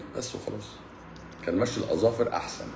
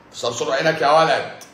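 louder, higher, hammed-up threat; mock threat; 7 semitones higher, 6 dB louder than usual. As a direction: bark it like a playful menace. Repeats threat, punched up.

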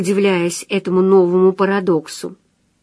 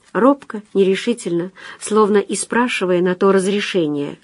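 ordinary delivery, nothing marked

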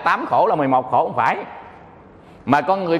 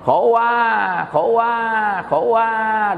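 newborn cry as words; 4 semitones higher, much slower than usual. Pretend. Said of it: "nghe kỹ lại khóc là gì".

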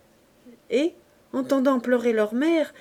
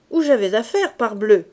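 dismissive retort; 8 dB louder than usual. A narrator's reading. Man shrugging off his partner's question, loud and dismissive. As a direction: brush the question off. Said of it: "avec un haussement d'épaules".

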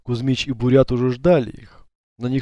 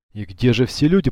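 usual speaking voice

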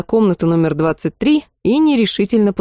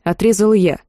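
ordinary delivery

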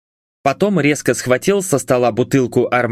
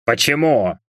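hoarse one-word demand; understated hoarse question; wheezed. A narrator's reading. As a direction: rasp it out slightly. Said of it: "прохрипел Левин".

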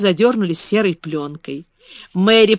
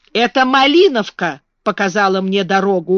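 ordinary delivery, nothing marked

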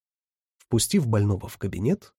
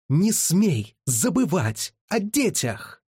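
much higher, slowed-down yell; slow, high furious shout; 8 semitones higher, much slower than usual. A narrator's reading. Маляка кричит на мужа, чтобы помнил о детях: протяжный крик. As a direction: drag out each word, screaming it high. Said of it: "выкрикнула она".

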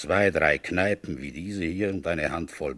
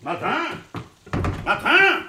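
calling out loud; 13 semitones higher, 3 dB louder than usual. Acted. Commissioner calling for his gendarme in the empty office, loud shout. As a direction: shout the name high and loud across the office.

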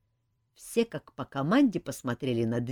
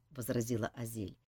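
ordinary delivery, nothing marked

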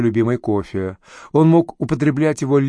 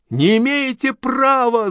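high, slow shriek mimicking the boss; shrieking yell; 12 semitones higher, slower than usual. A narrator's reading. Girl screaming back at her boss in his tone. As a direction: screech it back at him. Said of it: "заорала вдруг Галя в тон Аркадию Петровичу".

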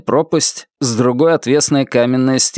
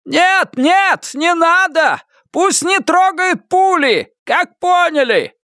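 urgent shout over the radio; slower than usual. A narrator's reading.

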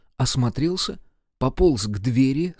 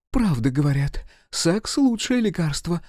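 raised, enthusiastic exclamation; exclaiming; 6 semitones higher than usual. A narrator's reading. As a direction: exclaim it, climbing higher at the end. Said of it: "восклицала Марина Олеговна". (воодушевлённо восклицать)

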